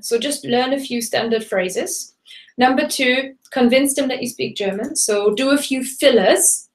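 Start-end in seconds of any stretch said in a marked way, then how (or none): none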